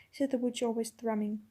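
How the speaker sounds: ordinary delivery, nothing marked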